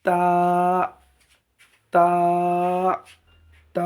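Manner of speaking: তা with tongue tip behind the upper teeth, held long; touch tongue to upper tooth ridge and stretch তা; careful, sustained dental তা; tongue-tip articulation. Shown as a demonstration. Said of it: "এইভাবে তা উচ্চারণ হবে".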